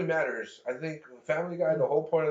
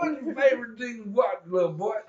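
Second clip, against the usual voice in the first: cartoon Cosby impression; 6 semitones higher than usual. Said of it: "exposure to him was The Simpsons".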